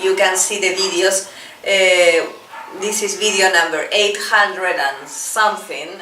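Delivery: ordinary talk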